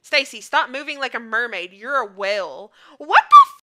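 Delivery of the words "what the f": high, loud yelp, cut off; startled high-pitched cry; shocked outburst. Real, not acted. Shocked reaction during the game.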